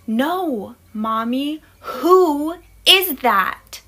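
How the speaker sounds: put-on high, drawn-out child voice; slow, high little-kid insistence; insistent toddler. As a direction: stretch out each word like an insistent small child. Son insists in scary story.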